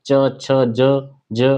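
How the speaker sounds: "च, छ, ज" one by one, tongue touching the palate; very slow teacher's drill of "च, छ, ज" from the palate; palatal sound demo. Shown as a demonstration. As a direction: slowly sound out "च, छ, ज" as separate syllables. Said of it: "बोलो, बोल के देखो आप".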